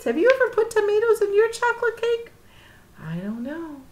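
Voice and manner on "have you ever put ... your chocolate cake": plain reading voice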